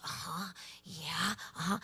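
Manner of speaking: quietly nodding along to the invisible friend; hushed listening. Retold or imitated. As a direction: murmur as if hearing the angel. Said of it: "he goes".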